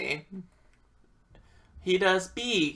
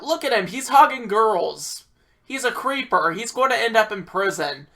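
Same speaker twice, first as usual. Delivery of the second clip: high, raised-voice retold argument; heated accusation; 6 semitones higher, 5 dB louder than usual. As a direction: argue it indignantly, pitch pushed up.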